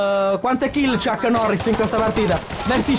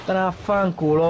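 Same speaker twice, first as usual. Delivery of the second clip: ordinary talk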